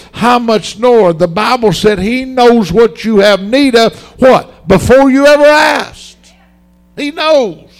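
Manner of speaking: preacher working the crowd up; rising preaching. Real, not acted. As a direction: build the call to a peak. Preacher building up.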